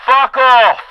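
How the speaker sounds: loud, high shouted dismissal; megaphone yell. Pretend. Comedian yelling at a fan through a pretend megaphone, loud. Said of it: "It wasn't loud enough".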